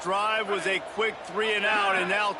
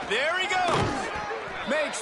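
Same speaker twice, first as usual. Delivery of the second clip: excited call; 9 semitones higher, slower than usual. Real, not acted. Live run call.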